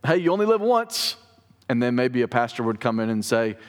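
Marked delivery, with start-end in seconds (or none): 0.0-1.2 high-pitched mock catchphrase; lightly put-on voice of the culture; quoting popular saying. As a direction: toss out a cultural slogan in a raised voice. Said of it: "Sort of like the way we have".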